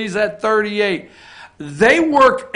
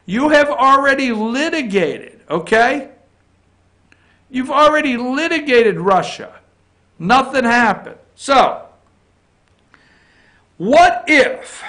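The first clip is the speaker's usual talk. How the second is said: raised pitch, lightly voiced Trump scolding; Trump impression; 5 semitones higher than usual. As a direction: lecture them like Trump in the Rose Garden. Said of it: "He says".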